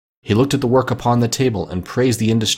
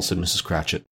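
ordinary delivery, nothing marked

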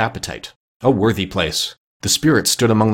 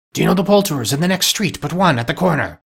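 higher-pitched, lively question; eager inquiry; 7 semitones higher than usual. A narrator's reading. Scrooge asking boy about the poulterers, eager inquiry. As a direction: ask brightly, pitch raised. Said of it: "Scrooge inquired".

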